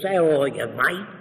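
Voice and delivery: put-on Australian greeting; Australian accent. Shown as a demonstration. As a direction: greet a pal in imitated Australian. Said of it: "If he's brought up in Australia".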